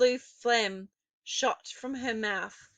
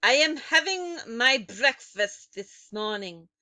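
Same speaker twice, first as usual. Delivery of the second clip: put-on loud, higher accented witch voice; witch crying out; 9 dB louder than usual. A narrator's reading. Grand High Witch complaining to her audience, loud. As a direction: cry it out as the angry witch. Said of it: "cried the Grand High Witch".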